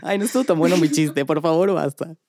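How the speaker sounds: ordinary delivery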